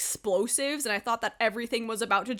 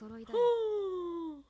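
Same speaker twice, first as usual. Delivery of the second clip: shocked gasp; 8 semitones higher than usual. Real, not acted. Shock at anime reveal.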